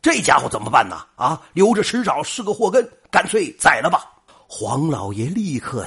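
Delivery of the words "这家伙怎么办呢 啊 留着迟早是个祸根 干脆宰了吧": raised, louder voice of a coarse bandit; loud rough robber. A narrator's reading. Robber urges gang to kill the captive, loud and rough. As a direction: speak up roughly, pushing for the kill. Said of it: "有一个粗野的声音大声说".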